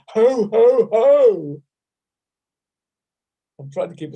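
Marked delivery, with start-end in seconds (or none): 0.1-1.6 loud round ho, ho; ho laugh sounds. Shown as a demonstration. Laughter teacher with the class, loud ho, ho. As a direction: boom out ho from the belly. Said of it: "And again".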